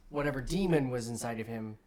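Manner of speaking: ordinary talk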